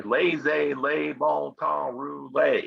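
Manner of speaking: slow Southern US drawl on the French phrase; crazy Cajun accent. Retold or imitated. Teacher showing how New Orleans people say it, Southern US accent. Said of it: "with this crazy accent".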